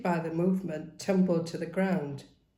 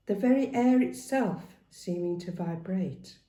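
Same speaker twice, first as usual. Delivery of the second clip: ordinary delivery, nothing marked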